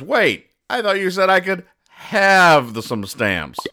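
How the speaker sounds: confused protest. Retold or imitated. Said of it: "And Crankshaft goes".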